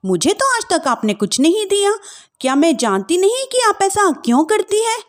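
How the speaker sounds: mild scornful outburst; angry young wife. Acted. Wife argues with mother-in-law.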